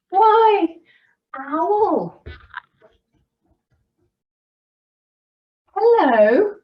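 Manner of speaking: high, loud sing-out greeting; lightly voiced mouse. A narrator's reading. Mouse greets Owl in the woods, high acted hello. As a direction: call it out high like a cheery mouse.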